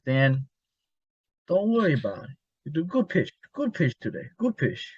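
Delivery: a hint of a Spanish accent, friendly and high. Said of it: "I even gave him a little accent".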